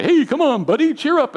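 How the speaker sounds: very high, loud, hammed-up heartiness; mock cheer-up. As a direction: chirp it like an overeager pep talk. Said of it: "a joke at the wrong time".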